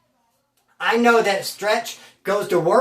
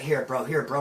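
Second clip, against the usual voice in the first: sheepish handover; 7 semitones lower, 6 dB quieter than usual. Retold or imitated. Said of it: "He's like".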